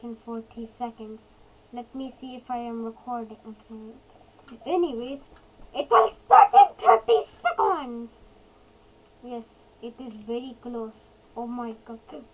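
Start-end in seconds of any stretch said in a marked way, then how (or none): 5.7-8.2 high, loud excited announcement; excited countdown shout. Real, not acted. Streamer warning viewers the event will start, excited shout. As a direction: call it out, high and thrilled.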